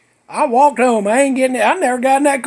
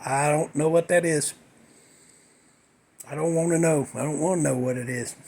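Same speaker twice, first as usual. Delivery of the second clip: much lower, softer, in mock dread; scared inner voice; 10 semitones lower, 7 dB quieter than usual. Narrator's brain shutting down at the spider, in low, soft mock dread. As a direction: mutter it to yourself in mild mock horror.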